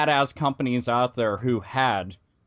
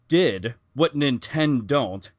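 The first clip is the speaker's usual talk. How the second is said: usual speaking voice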